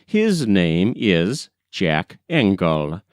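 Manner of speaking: usual speaking voice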